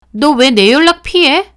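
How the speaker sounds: loud, high demand why she's ignored; accusing shout. Acted. Friend confronting her at the shop, loud accusing shout. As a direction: call her out loud and hurt.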